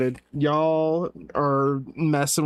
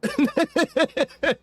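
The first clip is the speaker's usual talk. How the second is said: high-pitched burst of real laughter; 11 semitones higher than usual. Real, not acted. Laughing at chat's explanation of the layering, a high burst of laughter. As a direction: crack up helplessly, high.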